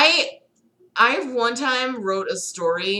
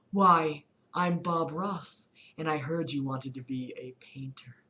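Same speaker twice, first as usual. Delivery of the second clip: whispered Bob Ross; 4 semitones lower, 6 dB quieter than usual. Acted. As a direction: whisper it like a gentle secret. Bob Ross reveals himself.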